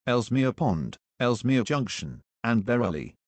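choppy automated station announcement; recorded train PA, words pieced together; spliced together words. Acted.

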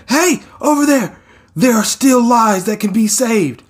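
rally the rescuers across the rubble; hero calling out. Acted. Hero calling rescuers toward survivors, high rallying shout.

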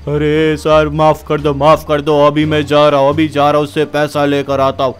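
slurred, sorry-sounding henchman; drunk goon pleading. Acted. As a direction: grovel to the angry boss. Undertaker begs forgiveness. (डरे हुए नशेड़ी गुंडे की गिड़गिड़ाहट)

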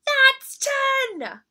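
very high, loud gleeful roar. Acted. Little bear shows ten claws to Mama, roaring high and loud. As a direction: roar it like a cub showing both paws. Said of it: "and roared".

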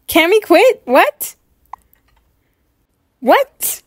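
usual speaking voice

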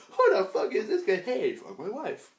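high-pitched, hammed-up homeowner voice; mock outrage turning to stretched-out welcome; startled householder. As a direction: put on a high, comic voice greeting an intruder. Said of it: "I just walk into people's houses".

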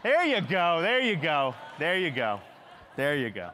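loud and high, then settling down each time; fading sing-song. Acted. New dad shrugging off his small part, fading singsong. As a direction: let it wind down like a shrug.

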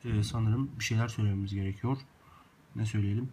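normal talking voice

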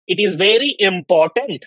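slow, with important drawn out and pressed; stress on important. Shown as a demonstration. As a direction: lean hard on important in It is very important. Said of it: "यहाँ पे आप important पे आप stress दे रहे हो".

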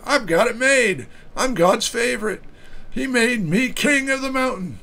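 high, boastful crowing voice, pitched well up; smug crowing. Acted. Pastor reading the psalmist's boast to his congregation, high smug crowing. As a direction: crow it like the proud king of the mountain. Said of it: "I crowed".

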